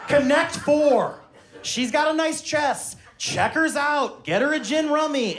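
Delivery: slow, high-pitched punchy proclamation; emphatic dad lecture. Retold or imitated. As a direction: hammer each phrase out, pitched high. Dad's pun lecture peaks.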